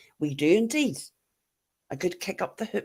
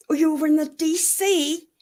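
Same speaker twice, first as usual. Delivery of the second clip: surprised; 11 semitones higher, 7 dB louder than usual. Real, not acted. Surprised reading chat.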